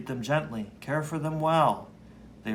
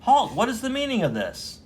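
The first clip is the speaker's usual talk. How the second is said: high, loud command; commanding shout; 9 semitones higher, 5 dB louder than usual. Acted. A returning son halting the witch execution, loud and commanding. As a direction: stop the mob with one word.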